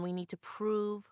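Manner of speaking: usual speaking voice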